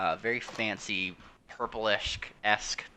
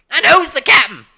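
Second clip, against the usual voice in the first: much higher and louder character voice; loud pirate voice; 17 dB louder than usual. Acted. Pirate hailing the other ship, loud brash voice. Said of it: "he's just like".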